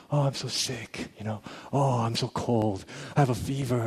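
a sorry-for-himself moan; mock sick whine.

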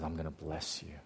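very soft, low voice of blessing; tender promise. Retold or imitated. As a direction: promise it gently, almost hushed. God promises a blessing softly.

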